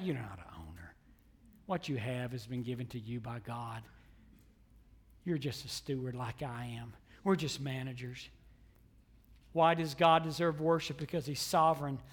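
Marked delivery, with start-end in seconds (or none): 0.0-8.3 low, soft aside to the congregation; quiet, lowered pulpit voice; hushed preaching. Real, not acted. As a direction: drop the voice right down to make the point. Preacher drops voice for effect.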